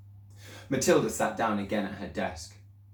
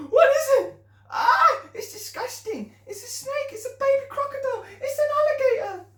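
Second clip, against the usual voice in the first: put-on very high, loud, squealing kids; squealing children; 6 dB louder than usual. Children shrieking at the newt in the glass.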